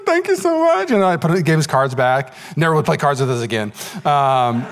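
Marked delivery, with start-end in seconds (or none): 0.1-0.9 lightly mimicked friend. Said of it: "he's like".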